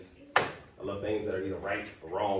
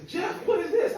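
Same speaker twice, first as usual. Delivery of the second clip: put-on much higher, incredulous girlfriend; girlfriend's voice; 7 dB louder than usual. Girlfriend shocked at his report card, high incredulous voice. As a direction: squeak it out in disbelief.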